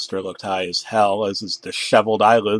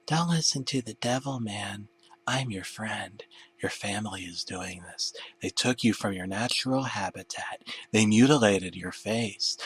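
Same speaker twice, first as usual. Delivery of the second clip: much softer, secretive; hushed persuasion; 10 dB quieter than usual. Acted. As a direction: lure him in with hushed words. Demon face whispers persuasively.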